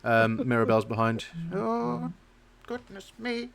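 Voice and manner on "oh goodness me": put-on high, creaky old lady voice; creaky old woman. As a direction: quaver like a frail old woman. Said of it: "your voice came out really creaky".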